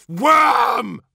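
high, loud scream of contempt; one-word enraged shriek; furious scream. A narrator's reading. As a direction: scream it at a cowering underling. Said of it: "screamed Fulgrim".

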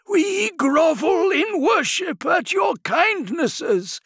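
high, slow warbling sorcerer voice. A narrator's reading. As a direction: warble a slow, exalted prayer. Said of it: "His warbling voice".

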